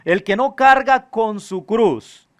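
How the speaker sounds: slow, emphatic Gospel reading, phrase by phrase; solemn scripture reading. Acted. Preacher reading Jesus' demands to the congregation, solemn scripture reading. Reads the Gospel passage aloud.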